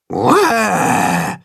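drawn-out falling mock roar; demonstrated roar. A narrator's reading. Tacho shows the monster how, with a mock roar. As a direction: give a scary "uah" roar. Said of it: "macht Tacho ihm vor".

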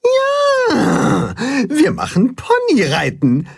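high squeal of joy, then eager; delighted. A narrator's reading. Two ponies look forward to giving rides, delighted. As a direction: burst out happily in a high voice. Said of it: "freuen sich".

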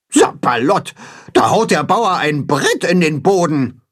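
mildly hammed-up goose outrage; Grimm, ranting. A narrator's reading. Goose Grimm sees the farmer plant a board, ranting. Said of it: "wettert Grimm".

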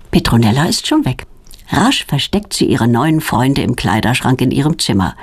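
usual speaking voice

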